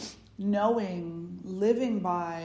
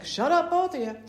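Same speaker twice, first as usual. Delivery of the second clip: much higher, louder exasperated mom; fed-up mom snapping; 9 semitones higher, 8 dB louder than usual. Retold or imitated. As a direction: shout them down like a tired mother. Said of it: "And Mom's like".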